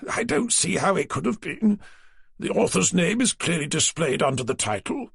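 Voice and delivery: a prim, slightly pompous office man; publisher Naylor. A narrator's reading. Naylor's voice again.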